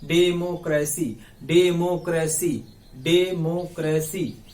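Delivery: Democracy repeated slowly as डे-मो-क्रे-सी; syllable by syllable. Shown as a demonstration. Teacher drills students on four syllables, slow syllable by syllable. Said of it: "बोल के देखो ना".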